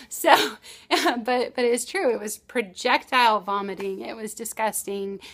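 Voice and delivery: ordinary talk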